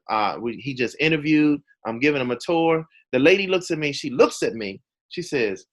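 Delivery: normal talking voice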